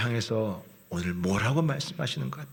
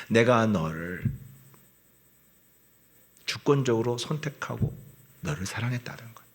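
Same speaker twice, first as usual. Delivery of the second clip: ordinary talk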